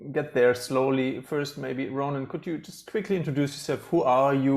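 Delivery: normal talking voice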